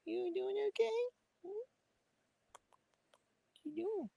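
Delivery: high soft cooing pet voice; sweet high puppy talk. Real, not acted. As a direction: coax the frightened dog gently in a squeaky voice. Coaxing a scared dog.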